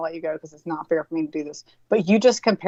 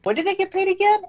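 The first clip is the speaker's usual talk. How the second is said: mock-puzzled father's question, much higher; imitating father; 12 semitones higher than usual. Retelling what her father would ask, in a higher mock voice. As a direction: play the baffled dad asking again. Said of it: "he'd be like".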